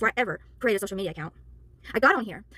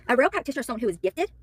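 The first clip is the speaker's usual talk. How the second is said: usual speaking voice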